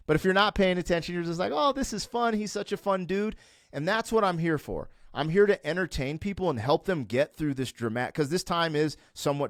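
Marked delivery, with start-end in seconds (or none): 1.5-3.4 higher-pitched, lightly mimicked easy approval; carefree viewer. Imagined viewer casually enjoying his videos, lightly mimicked carefree approval. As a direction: lightly imitate an easily entertained fan.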